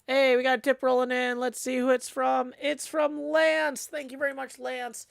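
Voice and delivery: high, loud, delighted; excited. Real, not acted. Excited tip announcement.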